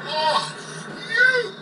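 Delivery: sudden high yelp of surprise; a voice cracking high in shock; startled cry. Acted. Brain in a jar startled by Raz, crying out high.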